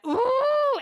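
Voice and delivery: high, loud shriek of anger; furious shriek. Retold or imitated. Mom losing it at her daughter, loud shriek. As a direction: let out an outraged shriek. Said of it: "And I went".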